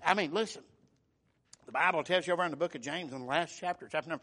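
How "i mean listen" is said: ordinary talk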